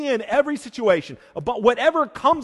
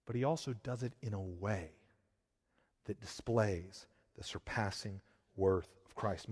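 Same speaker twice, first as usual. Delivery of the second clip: much softer, lower, intimate voice; hushed preaching; 11 semitones lower, 15 dB quieter than usual. Acted. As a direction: draw them in with a near-hushed tone. Sermon drops to intimate close.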